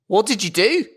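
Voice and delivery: high, eager mother; mum voice. Retold or imitated. Mum quizzing Norbert, in a high eager voice. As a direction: ask it like an excited mum. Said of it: "She goes".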